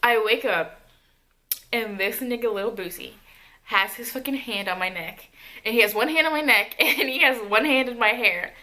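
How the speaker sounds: plain reading voice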